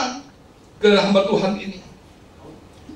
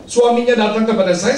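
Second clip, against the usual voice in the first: ordinary delivery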